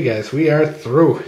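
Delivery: normal talking voice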